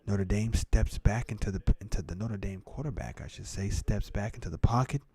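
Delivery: hushed, mellow, slowed down with long pauses; mock ASMR host. Streamer calling the football game as ASMR, hushed. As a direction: half-whisper the game commentary, calm and unhurried. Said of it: "we're transitioning to the A S M R".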